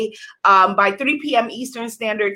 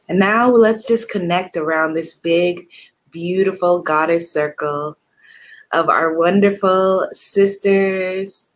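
guided meditation; 4 semitones lower than usual. Acted. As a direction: guide them into calm breathing. Leads a guided breathing meditation.

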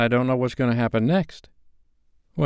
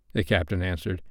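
usual speaking voice

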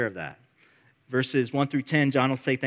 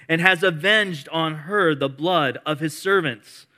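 loud, higher, ringing proclamation; preaching praise; 5 semitones higher, 7 dB louder than usual. Acted. Pastor voicing the multitude's praise, loud proclamation. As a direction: declare it with full force.

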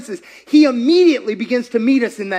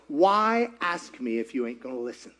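low and soft, wry; dry soft God voice; 9 semitones lower, 11 dB quieter than usual. Retold or imitated. God asking the preacher why he asked, low and soft. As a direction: let God answer deadpan and quiet. God's reply.

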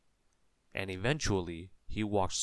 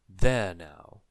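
loud declaration; 12 dB louder than usual. A narrator's reading. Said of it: "said the wizard".

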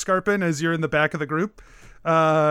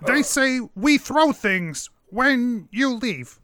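high, slow kobold voice; halting broken speech, pitched up; threatening kobold; 5 semitones higher, slower than usual. Acted. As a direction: relay the threat as a little creature.

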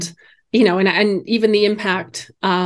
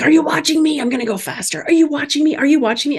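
put-on high, eager child's voice; breathless show-off kid, pitched high; excited little boy; 7 semitones higher than usual. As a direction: imitate a kid begging to be watched.

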